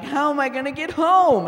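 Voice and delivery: high, loud panicked cry; comic mock distress at an empty gas tank. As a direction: blurt it out in fake alarm. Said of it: "I was like".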